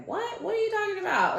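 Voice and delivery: very high-pitched puzzled question; baffled mom. Retold or imitated. Mom asked about a coin on the phone, baffled. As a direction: imitate a mom's high, startled confusion.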